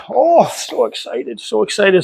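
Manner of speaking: high, slow cooing to a puppy. Real, not acted. Cooing over his excited dog.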